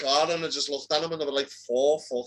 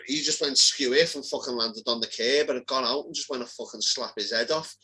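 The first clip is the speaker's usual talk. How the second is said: ordinary delivery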